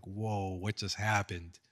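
low, hushed stunned reaction, retold; frozen in shock. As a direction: drop the voice, as if stunned. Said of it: "like, we all froze".

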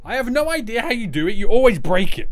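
high, loud complaint; worked-up, loud exasperation; exasperated rant. Real, not acted. Rants at viewer for breaking it.

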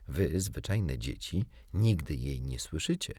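ordinary delivery, nothing marked

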